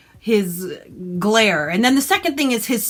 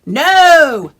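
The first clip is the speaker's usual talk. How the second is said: loud no; 3 semitones higher, 9 dB louder than usual. Real, not acted. Loud rejection.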